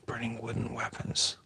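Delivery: whispered to self; whispered rereading of a question. Real, not acted. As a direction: mutter it under your breath, voiceless. Rereading chat question under breath.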